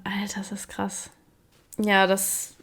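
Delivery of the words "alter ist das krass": breathless awed whisper. Real, not acted. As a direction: whisper it in disbelief. Repeats phrase, then whispers it.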